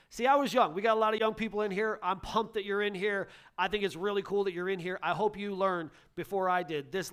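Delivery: loud, high, fired-up preaching; full-force preaching. Acted. Pastor rousing the young people in the congregation, loud excited preaching. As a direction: pump the room up with it.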